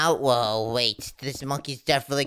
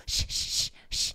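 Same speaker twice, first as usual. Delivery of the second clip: shushing; 8 dB quieter than usual. Real, not acted. Hushing while spying.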